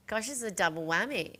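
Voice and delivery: ordinary talk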